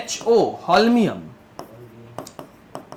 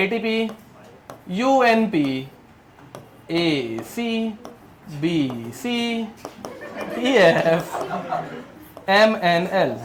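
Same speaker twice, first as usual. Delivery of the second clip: slow dictation; much slower than usual. Shown as a demonstration. Teacher giving students a mnemonic to memorise, slow and spaced out. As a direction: piece by piece with long pauses.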